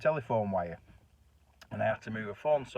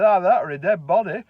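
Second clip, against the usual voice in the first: much louder, gleeful spooky jab; loud teasing punchline; 8 semitones higher, 11 dB louder than usual. Pretend. Scaring the husband with a dead body, loud and teasing. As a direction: land the scare with relish.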